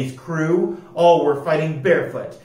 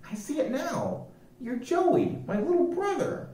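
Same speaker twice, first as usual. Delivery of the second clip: soft, much higher wondering voice; tender recognition; 10 semitones higher, 8 dB quieter than usual. A narrator's reading. Annie recognizing her brother, soft and tender. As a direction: realize gently who he is.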